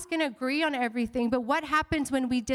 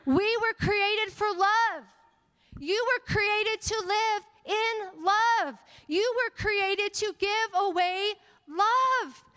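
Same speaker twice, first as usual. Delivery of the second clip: fervent preaching; 5 semitones higher than usual. Acted. Pastor urging the church that we were made for love, preaching.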